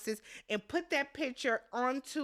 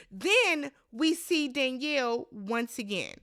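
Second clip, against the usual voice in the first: ordinary talk, nothing marked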